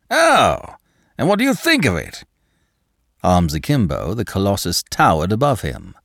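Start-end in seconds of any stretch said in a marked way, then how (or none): 0.1-2.2 tower over him and demand; challenging Danton. A narrator's reading. Danton's voice held.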